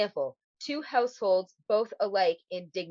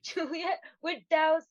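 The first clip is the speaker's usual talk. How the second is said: higher, mock-fancy wooing voice; fancy old English; 9 semitones higher than usual. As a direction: woo her in hammed-up old English. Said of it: "Fancy talk from Romeo and Juliet".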